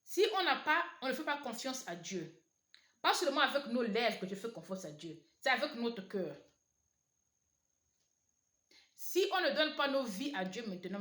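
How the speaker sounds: loud fervent preaching. Acted. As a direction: proclaim it to the congregation with urgency. Opening exhortation at full force.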